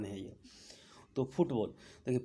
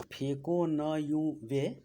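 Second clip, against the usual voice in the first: very slow, every syllable drawn out; teacher drilling a mnemonic, dragged out slowly; slow drilled mnemonic; much slower than usual. Shown as a demonstration. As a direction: spell out the memory trick slowly for students. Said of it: "उसके बाद trick याद होगा".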